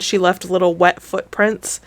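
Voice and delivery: usual speaking voice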